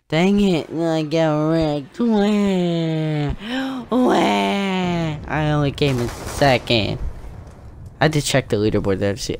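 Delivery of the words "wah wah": mock crying. Mock crying after losing.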